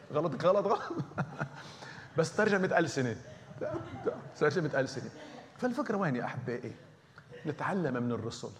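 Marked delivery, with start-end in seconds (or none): 0.1-0.8 repeating غلط through laughter; chuckling as you say غلط, غلط; amused at his own blunder, laughing. Real, not acted. 0.9-2.1 a high breathy burst of laughter. Real, not acted. 2.2-5.1 joking laugh. Real, not acted.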